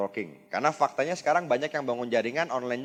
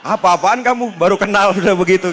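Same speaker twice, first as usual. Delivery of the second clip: higher, louder mock angry outburst, half laughing; angry retort; 9 semitones higher, 11 dB louder than usual. Stranger offended by the smoking joke, mock angry and half laughing. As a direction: imitate an offended stranger snapping back, laughing through it. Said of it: "Wah, orang langsung marah".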